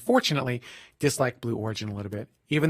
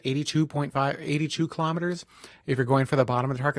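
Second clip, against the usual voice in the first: ordinary talk, nothing marked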